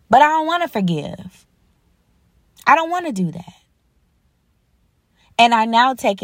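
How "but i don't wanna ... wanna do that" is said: high-pitched, reluctant protest; pleading refusal. Retold or imitated. Father answering God's command to forgive, acted pleading refusal. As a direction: imitate someone balking, pitch jumping up at the start.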